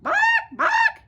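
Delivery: very high squawking bark; parrot squawk. Acted. The parrot answering Pete, very high squawk. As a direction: screech like a parrot.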